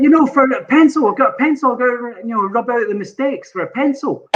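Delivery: mildly flustered retold explanation; earnest explaining. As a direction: spell it out, trying to clear up a misunderstanding. Retells explaining himself to puzzled secretary.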